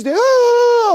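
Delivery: very high, loud cry of feeling; emotional outburst. Shown as a demonstration. Lecturer showing Mediterranean ladies pouring out all emotions, high loud exclamation. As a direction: let all the emotions burst out. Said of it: "all emotions".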